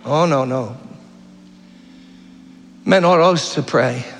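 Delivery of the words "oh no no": normal talking voice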